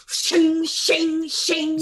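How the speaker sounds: high, loud sword shing noises; sword slash sound. Retold or imitated. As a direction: sing out a blade ringing through, thrice. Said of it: "like, repeatedly".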